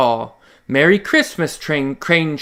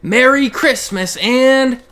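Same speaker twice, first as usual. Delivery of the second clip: drawn-out goodnight; 4 semitones higher, 4 dB louder, much slower than usual. Acted. Story ending, drawn-out goodnight.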